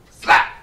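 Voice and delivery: a loud spoken slap effect; slap sound. Pretend. Host mimics the genital slap with a loud slap noise. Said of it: "mimics the slap".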